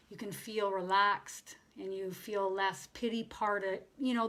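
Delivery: ordinary talk, nothing marked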